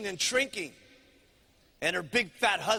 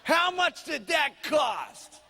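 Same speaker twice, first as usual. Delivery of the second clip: high, dragged-out demand; negative husband; 5 semitones higher, much slower than usual. Acted. Husband demands the price from his wife, slow and indignant. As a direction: draw the question out slowly, disgusted. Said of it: "fat, negative husband".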